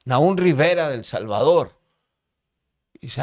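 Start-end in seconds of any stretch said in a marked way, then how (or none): 0.0-1.8 higher, louder retold callback; imitated prisoner shouting back; answering call. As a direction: call your name across to another cell. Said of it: "yo le contesté".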